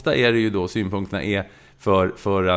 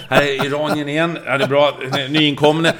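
loud, high live match call; commentating excitedly; 6 semitones higher, 7 dB louder than usual. Retold or imitated. Commentator avoiding a player's name, loud and high. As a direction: dodge the name with eager play-by-play. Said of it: "Jag hittade så många synonymer".

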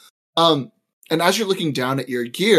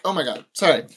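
usual speaking voice

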